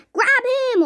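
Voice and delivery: high, loud command; urgent shouted order. A narrator's reading. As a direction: order it fast. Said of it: "ordered Mister Wonka".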